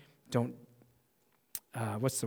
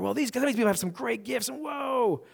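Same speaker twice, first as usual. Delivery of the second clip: mock awe; 9 semitones higher than usual. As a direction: gush in wonder, climbing to a big whoa. Voices envy of others' gifts.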